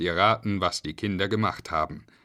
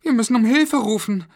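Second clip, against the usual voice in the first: put-on high, loud alarmed girl; squeaky, urgent kid's voice; Julia; 13 semitones higher, 8 dB louder than usual. A narrator's reading. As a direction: cry out like a child in trouble. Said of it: "beschloss Julia".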